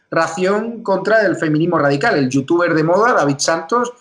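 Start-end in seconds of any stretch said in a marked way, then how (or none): none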